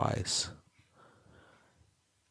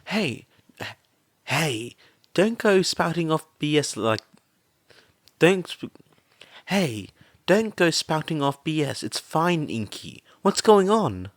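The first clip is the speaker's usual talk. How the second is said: brash friend, higher and firm; gruff reassurance; 8 semitones higher, 4 dB louder than usual. A narrator's reading. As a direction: reassure her roughly. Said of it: "Mitsuki assured".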